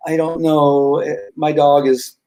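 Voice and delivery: acted unsure, fretful client; timid dog owner. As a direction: dither nervously over the dog. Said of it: "kinda timid".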